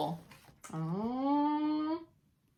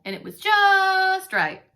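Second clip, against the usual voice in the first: slow, higher and louder, leaning on just; savoring just right; 8 semitones higher, 8 dB louder, slower than usual. A narrator's reading. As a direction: stretch out just right with delight.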